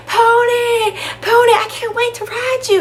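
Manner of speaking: put-on very high, squealing child voice; excited little kid. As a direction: call out to the pony in giddy delight. Child running excitedly to the pony.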